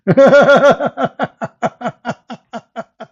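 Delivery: loud, high burst of laughter trailing off; real laughter. Real, not acted. Ridiculing the translation, a loud high laugh. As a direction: burst out laughing, then let it die away.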